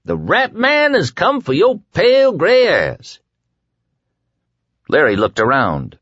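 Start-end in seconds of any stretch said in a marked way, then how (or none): 0.0-3.2 high-pitched gleeful taunt; gleeful call. A narrator's reading. Rat Man comes for the prisoner, gleeful taunt. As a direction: whoop it at the top of the range. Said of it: "a gleeful voice called in".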